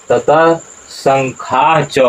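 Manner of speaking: "ततः शंखाश्च" very slow, each syllable distinct, शंखाश्च ending in a breathy h before च; teacher slowly modelling "ततः शंखाश्च" for students; correct recitation. Shown as a demonstration. धीरे-धीरे शुद्ध उच्चारण "ततः शंखाश्च". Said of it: "शुद्ध उच्चारण पर ध्यान दीजिए".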